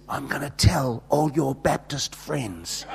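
slow, soft, low mock whisper; whispering in ear. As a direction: whisper it slowly as a sly threat. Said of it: "just whispering in her ear".

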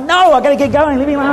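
laughing mock plea to escape; mock-frantic brush-off. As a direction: push them off in comic panic. Fleeing, laughing protest.

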